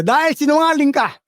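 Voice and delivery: high, loud shouted accusation; furious fairy shouting at the woodcutter; angry fairy. Retold or imitated. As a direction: condemn the liar with a shout. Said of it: "galit na galit yung diwata".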